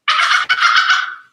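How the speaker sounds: high, loud squeaky dolphin laugh; dolphin chatter. Shown as a demonstration. Mermaid to kids, imitating a dolphin's high chatter. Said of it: "they kinda sound like this".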